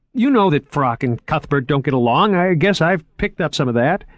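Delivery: an excited curator showing off; eager young curator. Acted. Narrator voices Moriarty.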